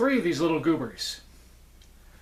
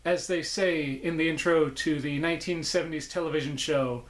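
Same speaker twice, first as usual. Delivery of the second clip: usual speaking voice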